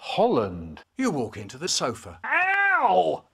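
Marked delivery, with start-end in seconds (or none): 2.2-3.2 ow. Acted. Walks into the sofa.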